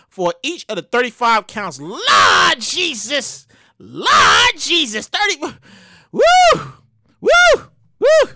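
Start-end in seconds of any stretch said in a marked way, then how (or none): 1.8-7.2 loud, slow drawn-out cry to the Lord; slow, loud holler of Lord Jesus; exclaiming. Real, not acted. Exclamation at sentence. 7.2-8.3 high whoop. Real, not acted. Whoop at number.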